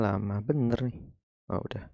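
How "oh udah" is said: ordinary talk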